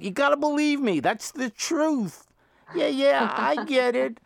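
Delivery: mock plea after people who walk away; calling after them. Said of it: "I call out".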